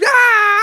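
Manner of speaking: high, loud mock scream of pain; imitated scream. Telling co-host how his bitten friend reacted, mock scream. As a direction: imitate a bitten kid's long wail. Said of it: "he screamed".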